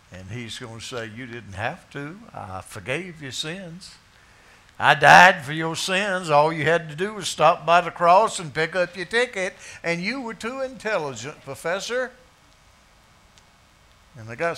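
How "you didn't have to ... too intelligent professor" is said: the Lord confronting a proud professor; Jesus speaking. Acted. Preacher voicing Jesus judging.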